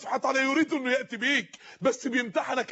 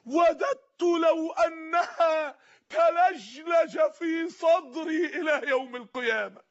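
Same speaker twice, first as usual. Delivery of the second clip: tearful, very slow, raised cry; crying plea; 4 semitones higher, much slower than usual. Acted. Dying pious man to his visitors, weeping and slow. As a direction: wail the wish through tears, stretching each word.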